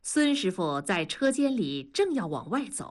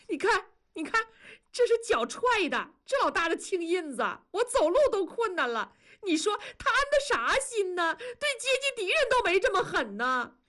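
much higher, crying and half-laughing in despair; tearful complaint; 11 semitones higher, faster than usual. Acted. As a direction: show him the bruise and weep out the injustice. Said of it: "立刻声泪俱下". (向孙师傅展示伤痕，声泪俱下)

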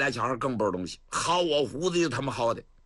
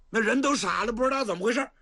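plain reading voice

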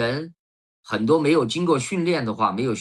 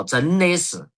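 higher-pitched Sichuan dialect tag phrase; Zhou Yang's Sichuanese catchphrase; 5 semitones higher, slower than usual. Retold or imitated. Quoting a drama character's pet phrase, in Sichuan dialect. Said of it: "周扬经常说的那句话叫".

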